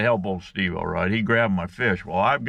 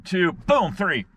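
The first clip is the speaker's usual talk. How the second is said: sudden count; 10 semitones higher, slower than usual. Retold or imitated. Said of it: "I said".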